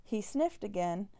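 usual speaking voice

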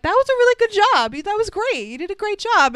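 high, loud, hammed-up congratulations; mock-gush to a fellow actor after his performance; gushing praise. Retold gushing praise for captain's act.

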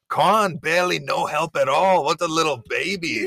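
high mock host in a botched Aussie accent; botched Australian accent. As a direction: call someone out in a wobbly fake Australian accent. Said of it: "what the fuck accent we're slipping into".

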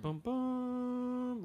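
mock trumpet blast, high and sung; imitated trumpet. Ghostly trumpet heard over the phone, mock trumpet blast. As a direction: toot it out like a horn. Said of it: "real loud".

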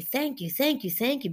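mild imitation of constant thanking; grateful repeated thanks. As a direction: gush the thanks a little. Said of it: "and just constantly".

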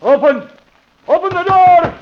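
high, loud urgent shout; shouting at door. Acted. Surgeon shouting outside the house to be let in, loud and urgent. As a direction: bang on the door and yell.

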